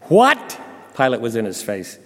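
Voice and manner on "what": incredulous outburst. Acted. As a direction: demand an answer, high and loud. Pilate confronts silent Jesus.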